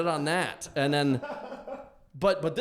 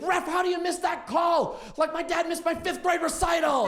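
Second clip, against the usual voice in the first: hammed-up shout at the referee; a buddy yelling at the TV ref; imitating an angry sports fan; 10 semitones higher than usual. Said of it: "he's like".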